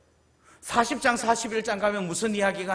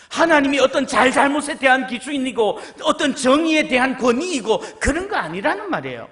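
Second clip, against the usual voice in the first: loud emphatic repetition on 하나님; preaching; 5 semitones higher, 7 dB louder than usual. Real, not acted. Preacher exhorting the congregation, loud. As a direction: build the list with force.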